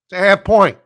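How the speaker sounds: fed-up loud correction; exasperated shout. Real, not acted. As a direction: blurt out "half point" in frustration. Exasperated repeat of "half point.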